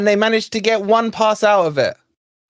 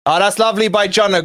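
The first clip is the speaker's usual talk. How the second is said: ordinary talk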